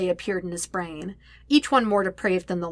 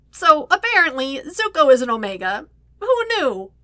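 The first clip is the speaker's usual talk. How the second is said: tense levity; 7 semitones higher, 5 dB louder than usual. A narrator's reading. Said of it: "voice came out more tense than he intended".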